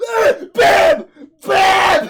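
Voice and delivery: very loud, high imitated bangs; hammed-up explosive shooting sounds; shouted gunshot noises. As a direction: blast out cartoon gunfire. Gunshots acted out.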